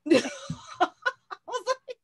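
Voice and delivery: loud, high burst of laughter; big high laugh. Real, not acted. Host laughing at her own internet meltdown, big high laugh.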